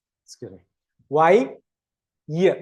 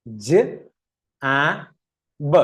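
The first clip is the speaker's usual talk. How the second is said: slow sounding-out; much slower than usual. Shown as a demonstration. Teacher starts reading a new word, slowly spaced sounds. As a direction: separate sounds with long gaps. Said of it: "വായിച്ചു നോക്കിയേ".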